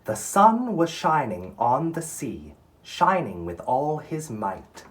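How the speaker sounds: poem reading. Acted. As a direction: read it like a storyteller savoring each line. Reads poem aloud to class.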